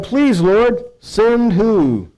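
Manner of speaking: slow, drawn-out plea, rising high at the end; reluctant prophet pleading, voice climbing high; pleading Moshe. Acted. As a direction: beg the Lord to send someone else, stretching it out. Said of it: "But he replied".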